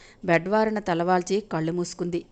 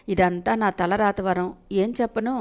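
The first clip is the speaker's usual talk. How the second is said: ordinary delivery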